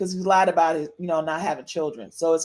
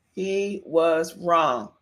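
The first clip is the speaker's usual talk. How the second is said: "He was wrong" slow, word by word, lower; deliberate emphasis; 3 semitones lower, much slower than usual. Shown as a demonstration. Host repeats the verdict on [name] for her listeners, deliberate emphasis. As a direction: stretch out "was" and land heavily on "wrong".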